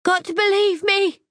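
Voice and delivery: very high, loud frantic cry; desperate shout. Acted. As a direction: scream to be believed. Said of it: "She's shouting".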